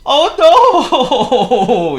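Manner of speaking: Fussy fearing his guest is back; dismayed cry. Acted. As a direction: cry out in comic dread. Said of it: "he groaned".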